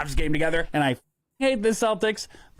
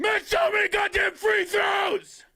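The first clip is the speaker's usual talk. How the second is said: exasperated shout; slower than usual. Acted. Yelling about the game.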